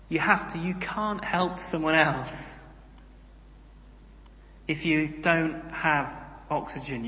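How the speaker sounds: normal talking voice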